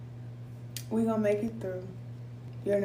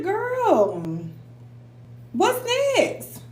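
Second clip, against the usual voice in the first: exasperated mom; 11 semitones higher, much slower than usual. Retold or imitated. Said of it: "I said".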